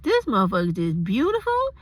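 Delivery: lovestruck gushing. Retold or imitated. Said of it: "I was like".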